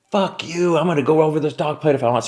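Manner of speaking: high-pitched, quick mock defiance; high, heated retold outburst; retold angry defiance. Retold defiant refusal in quarrel.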